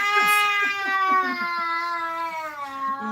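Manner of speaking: long, high, crying laugh; high wailing laugh. Real, not acted. Long laugh after plea.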